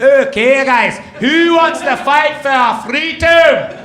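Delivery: high, loud, slow battle rallying cry; acted commander's call to arms; rallying war leader. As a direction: shout to rouse the troops before battle. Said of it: "William Wallace comes riding around".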